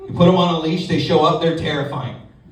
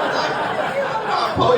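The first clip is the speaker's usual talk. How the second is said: mock protest. His own retort voiced.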